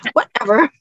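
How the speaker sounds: put-on amused teen brush-off; mock teenager. Speaker repeats teenagers' retort to critics, laughing mock dismissiveness. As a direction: imitate a dismissive teen through a laugh, rising at the end. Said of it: "what so many teenagers love to say".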